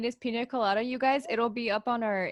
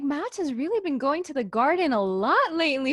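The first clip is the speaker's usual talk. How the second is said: gossiping relatives; 6 semitones higher, 3 dB louder than usual. Retold or imitated. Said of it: "they'll probably be like".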